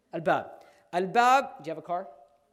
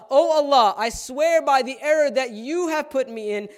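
loud, higher vow hurled upward; defiant Iblis; 6 semitones higher, 9 dB louder than usual. Acted. Preacher voicing Iblis swearing at Allah, loud and defiant. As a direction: threaten God with a raised voice. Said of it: "He's telling Allah".

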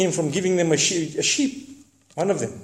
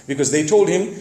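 ordinary talk

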